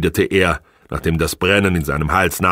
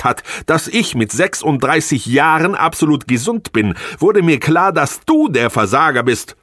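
scornful wife building up; 9 semitones higher than usual. Acted. Wife accusing husband of failure, rising to an outburst. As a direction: build until it bursts.

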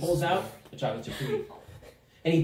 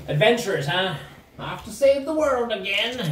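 theatrical gnome; 6 semitones higher than usual. Acted. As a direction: sing out a grand greeting, rising. Gnome hails the adventurers.

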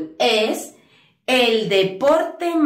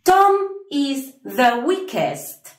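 slow, careful English model sentence; teacher pacing each word of the answer; much slower than usual. Shown as a demonstration. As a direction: spell it out slowly for students. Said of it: "añadimos E S T".

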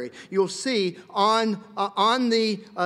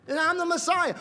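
mock claimant; 12 semitones higher than usual. Acted claimant in a dialogue.

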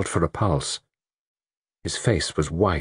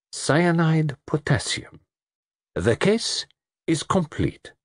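slow, high, deliberate pronouncement; solemn announcement; 9 semitones higher, slower than usual. Acted. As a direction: pronounce it slowly, with dramatic pauses. Poirot announces the damning evidence.

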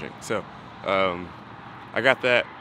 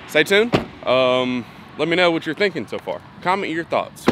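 upbeat sign-off; 6 semitones higher, 7 dB louder than usual. Real, not acted. Sign-off to viewers.

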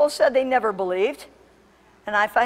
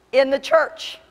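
loud, raised, forceful declaration; emphatic repeat; 5 semitones higher, 7 dB louder, slower than usual. Shown as a demonstration. Preacher driving the point home to congregation, loud emphatic repeat. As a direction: punch it out higher and louder.

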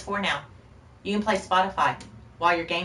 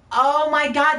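excited; 9 semitones higher, 9 dB louder, slower than usual. Real, not acted. Excited about friend's Viking hobby.

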